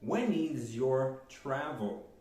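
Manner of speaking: very slow, every English word laid out clearly for students; slow teacher demo; slow model sentence. Shown as a demonstration. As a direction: spell it out slowly for the class. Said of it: "Por exemplo".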